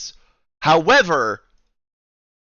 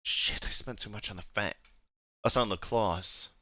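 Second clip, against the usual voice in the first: quiet muttered aside; 8 semitones lower, 15 dB quieter than usual. Acted. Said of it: "she's like".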